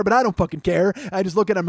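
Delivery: ordinary delivery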